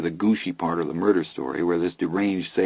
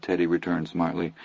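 plain reading voice

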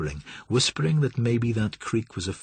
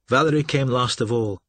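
plain reading voice

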